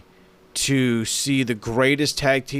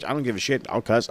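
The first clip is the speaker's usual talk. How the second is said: ordinary talk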